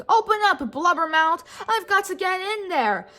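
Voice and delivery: loud, high yell to the whale; yelling to be let in. A narrator's reading. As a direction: shout it up at the whale's mouth.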